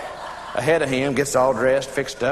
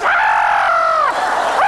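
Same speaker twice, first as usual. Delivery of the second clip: losing-it scream; 4 dB louder than usual. Acted. Wife seeing her husband on the couch, screaming. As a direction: scream it out in two long bursts. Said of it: "She loses it. She goes".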